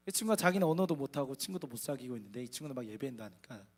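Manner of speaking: normal talking voice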